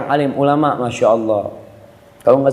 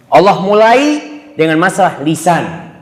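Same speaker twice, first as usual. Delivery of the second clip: usual speaking voice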